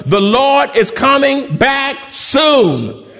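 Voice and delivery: shouted slowly, each word drawn out; loud call to the congregation. Shown as a demonstration. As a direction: call it out so the whole church answers. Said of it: "I wanna hear the biggest amen".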